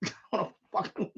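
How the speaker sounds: blurted through laughter, quickly; half-laughing, hushed aside. Real, not acted. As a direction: laugh the words out fast. Laughing while ranting about tenant.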